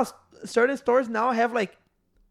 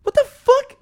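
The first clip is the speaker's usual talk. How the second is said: much higher, louder stunned outburst; high, loud "what the fuck"; shocked outburst; 9 dB louder, slower than usual. Retold or imitated. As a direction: yell it out in disbelief. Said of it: "I'm like".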